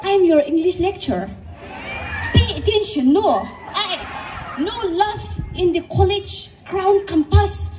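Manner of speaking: lecturer's put-on English accent, loud and stern; mock English accent. Lecturer warning students, in a mock English accent. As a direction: scold the class in a hammed-up English accent. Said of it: "English accent style wala".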